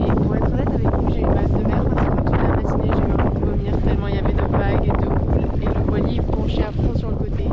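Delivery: calling out over the wind on a boat; shouting over wind. Real, not acted. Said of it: "J'espère que vous m'entendez parce qu'avec le vent". (parler fort pour couvrir le vent)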